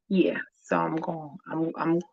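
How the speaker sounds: breathy whispered aside, voice dropped out; half-whispered trailing thought. Real, not acted. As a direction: let it fall to a whisper.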